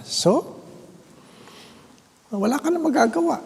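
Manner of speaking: high, fast comic whine; a high quick mock lament; resigned aside. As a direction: toss it off in a squeaky put-on voice.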